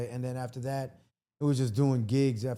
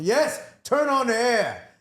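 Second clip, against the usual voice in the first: loud, higher-pitched, drawn-out demand; emphatic acted plea; 9 semitones higher, 7 dB louder, slower than usual. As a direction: beg loudly for the air, stretching it out. Acted reaction in funky bus story.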